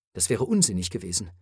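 plain reading voice

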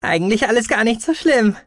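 high creature voice, pitched well up; frustrated Gucky. A narrator's reading. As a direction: state it high, as the little Ilt. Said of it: "konstatierte Guki mit einem frustrierten Blick". (hohe, leicht frustrierte Figurenstimme)

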